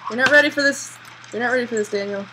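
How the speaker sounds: loud, pressing warning to the character; urgent warning. Real, not acted. Player warning his panicking character Daniel, loud. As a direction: push the warning hard at him.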